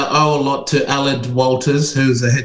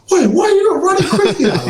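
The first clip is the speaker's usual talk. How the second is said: much higher, laughing mock coach; imagined coach asking why he isn't faster, high and laughing; mock coach question. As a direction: squeak it out through a laugh, teasing.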